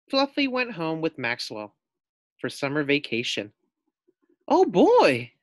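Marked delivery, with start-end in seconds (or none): 4.5-5.3 high, loud burst of glee; excited guinea pig. A narrator's reading. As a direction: squeal it with joy. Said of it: "thought Fluffy".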